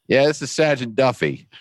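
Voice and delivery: high-pitched South Boston police sergeant; South Boston accent. Retold or imitated. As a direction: imitate a thick South Boston cop. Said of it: "South Boston, right down to his toes".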